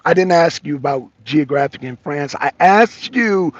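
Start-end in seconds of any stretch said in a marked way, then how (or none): none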